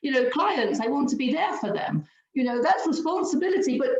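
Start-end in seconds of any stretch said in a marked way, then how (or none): 0.0-2.0 high, bright cheerful imitation; gleeful mock excitement; happy voice. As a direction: sing it out like a delighted child. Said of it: "It's like".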